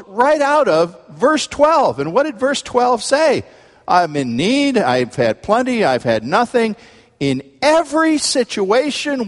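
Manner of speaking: high, emphatic sermon delivery; full-force preaching. Real, not acted. Preacher explaining Paul's words to the congregation, full-force preaching.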